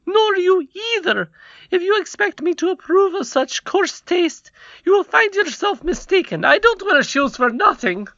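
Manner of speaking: high, loud indignant queen; angry queen voice. A narrator's reading. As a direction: scold him from high up. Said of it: "said the queen".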